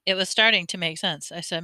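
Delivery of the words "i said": ordinary delivery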